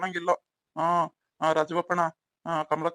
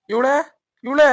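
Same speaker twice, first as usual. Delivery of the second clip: raised, higher-pitched summons; calling out; 6 semitones higher, 7 dB louder, slower than usual. Acted. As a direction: call for someone indoors. Calls wife from outside the house. (ಕೂಗಿ ಕರೆಯುವ ಧ್ವನಿ)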